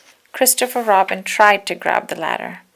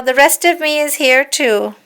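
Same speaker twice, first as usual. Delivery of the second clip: higher, louder cheeky tiger; bouncy Tigger; 7 semitones higher, 7 dB louder than usual. Acted. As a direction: play Tigger full of fun. Tigger answers.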